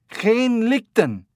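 slow, high, loud call in Dutch. A narrator's reading. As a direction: call up loudly to an ape. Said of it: "The heavy-set man said loudly".

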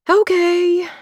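resigned okay on a big sigh; heavy sigh. Acted. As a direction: give in with a sigh. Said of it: "with a heaving sigh".

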